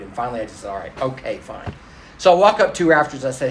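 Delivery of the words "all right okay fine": low, soft, grudging surrender; reluctant giving in. Retold or imitated. Man yielding to God after arguing, low and reluctant. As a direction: give in quietly after a long argument. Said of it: "I argued and argued and argued".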